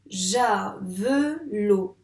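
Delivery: "javelot" split ja... ve... lo, loud and slow; teacher's slow ja, ve, lo; syllable by syllable. Shown as a demonstration. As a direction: clap out "javelot" in three separate beats. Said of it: "on tape les syllabes du mot".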